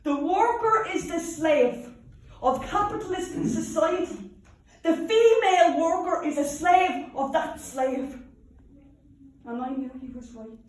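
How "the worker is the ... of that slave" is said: loud, high orator's delivery; impassioned speech. Acted. Union woman quotes Mister Connolly speaking at Liberty Hall, rousing oratory. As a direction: proclaim it slowly to a packed hall. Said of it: "One night I heard him speaking".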